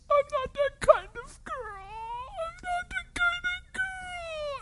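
high crying voice, slowing to the end; imitating a woman sobbing at the altar; desperate pleading. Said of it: "looks at me desperately and says".

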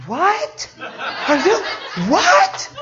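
can't believe what just happened; stunned disbelief. Retold or imitated. Lady discovering her wrist is healed, in shocked disbelief.

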